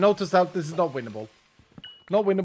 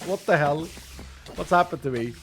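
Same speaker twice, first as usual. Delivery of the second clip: ordinary talk, nothing marked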